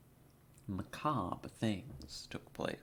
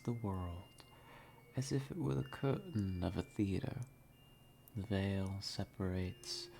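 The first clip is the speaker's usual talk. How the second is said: mysterious intro narration; 3 dB quieter than usual. Acted. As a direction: draw the listener in through the mist, half whispering. Dramatic whispered intro narration.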